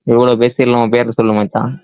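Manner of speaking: ordinary delivery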